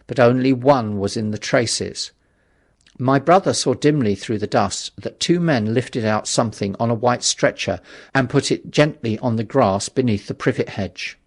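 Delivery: usual speaking voice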